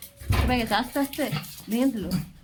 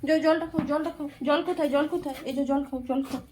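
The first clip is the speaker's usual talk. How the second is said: higher, sing-song coaxing to drink water; coaxing pet talk; 4 semitones higher than usual. Real, not acted. Owner coaxing pet to drink water, higher sing-song coaxing. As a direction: gently urge the little one to drink.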